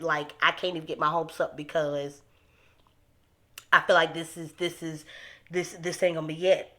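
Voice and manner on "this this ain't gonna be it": ordinary talk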